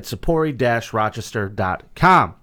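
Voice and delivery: ordinary delivery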